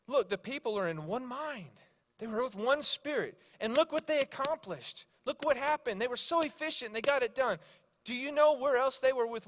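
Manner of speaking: high-pitched, mounting excitement; pumped. Retold or imitated. Coworker explaining what one mind accomplished, pumped. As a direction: build it up like an amazed discoverer. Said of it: "He was so pumped".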